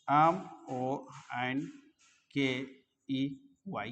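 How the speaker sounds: spelling it out slowly, letter by letter. Shown as a demonstration. Teacher spelling a word for students, slowly letter by letter. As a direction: pause on each letter so students can write.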